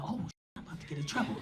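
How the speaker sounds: quick, near-whispered aside to oneself; hushed, hurried oh-no moment; hushed realization. Retold or imitated. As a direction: mutter it fast under the breath, acting out dread. Acts out private alarm at threat.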